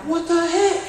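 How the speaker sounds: exasperated at the rifle; baffled. Retold or imitated. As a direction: cry out in disbelief. Confusion over gun.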